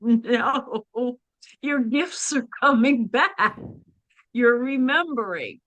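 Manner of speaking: delighted mother. Retold or imitated. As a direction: voice your mother's joyful reassurance, stretching it out. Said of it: "and she laughed, and she's like".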